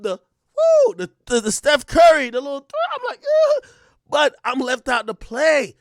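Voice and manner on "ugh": a shrill squeaked ugh; comic frustrated squeal; high frustrated groan. Retold or imitated. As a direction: whine it way up high. Left out of the action.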